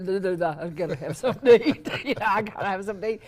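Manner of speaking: fast, laughing mock whine. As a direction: rattle it off through laughter.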